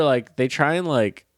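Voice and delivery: usual speaking voice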